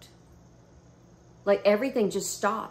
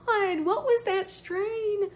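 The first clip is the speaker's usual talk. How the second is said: much higher, slow, amazed; stunned high exclaim; 13 semitones higher, slower than usual. Retold or imitated. Reacting to the trip in the waiting room, high slow amazed. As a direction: stretch it out in high disbelief. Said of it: "I'm like".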